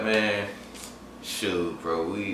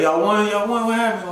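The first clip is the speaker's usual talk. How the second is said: high, loud, retold excitement; imitating an excited cousin; excited questioning; 13 semitones higher, 6 dB louder than usual. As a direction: call out eagerly for the news. Cousin excitedly asks about the win.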